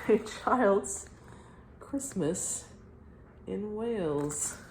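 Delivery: slow, soft announcing of the story title; title reading. Acted. Reading the book title to her viewers, slow and hushed. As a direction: announce the title slowly and quietly.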